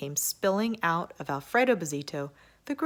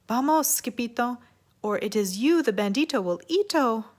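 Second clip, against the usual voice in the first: frightened cry; 7 semitones higher, 5 dB louder than usual. Acted. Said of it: "they cried".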